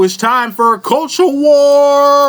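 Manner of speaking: drawn-out, high announcer call; hyped announcement. Pretend. Host kicks off a culture war segment, hyped announcement. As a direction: ring out the last word, stretched and high. Show intro, announcing the topic.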